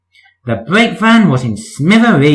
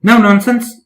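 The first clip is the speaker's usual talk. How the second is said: higher stern order; higher stern no-nonsense order; sharp; 7 semitones higher than usual. Acted. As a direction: put your foot down sharply. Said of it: "puffed Percy sharply".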